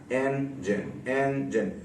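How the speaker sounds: Engine split slowly and softly into two beats; two separate syllables. Shown as a demonstration. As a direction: say engine with a gap between its syllables. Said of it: "दो मरतबा sound को". (Engine को दो हिस्सों में धीरे-धीरे)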